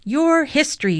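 high, loud taunting shout. A narrator's reading. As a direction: shout it at the rival team. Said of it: "shouted Francine".

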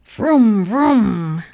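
revving car noise, loud and drawn out; big playful engine roar; loud engine vroom. A narrator's reading. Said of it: "said Mister Watson in his sleep".

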